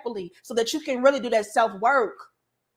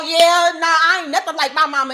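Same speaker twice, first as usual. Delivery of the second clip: loud, higher, scornful; defiant denial; 4 semitones higher, 6 dB louder than usual. Acted. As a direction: wave off the comparison with attitude. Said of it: "and say".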